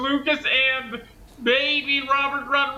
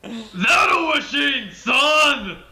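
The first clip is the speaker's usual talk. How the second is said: meme quote; 4 dB louder, much slower than usual. Retold or imitated. Quoting meme line.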